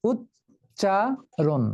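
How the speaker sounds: উচ্চারণ slow, উচ্-চা-রণ each beat apart; teacher drawing out উচ্চারণ syllable by syllable; syllables split. Shown as a demonstration. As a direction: tap out উচ্চারণ in three beats. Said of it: "উচ্চারণ করতে দিলেই তুমি clear হয়ে যাবে".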